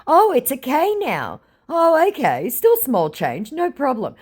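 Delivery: high, airy fake-eager voice; mock gushing. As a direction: play the gullible mark, all sweetness. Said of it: "I said".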